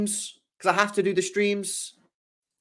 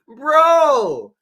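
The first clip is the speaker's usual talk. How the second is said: slow, louder, hammed-up doom through laughter; mock melodrama; 4 semitones higher, 6 dB louder, slower than usual. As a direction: overplay the tragedy while cracking up. Melodramatic parody of the headline.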